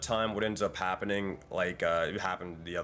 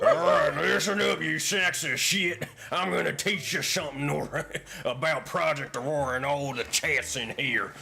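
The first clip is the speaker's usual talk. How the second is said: cowboy voice; 7 semitones higher, 5 dB louder than usual. Pretend. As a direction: ham up a swaggering cowboy. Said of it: "Cowboy voice".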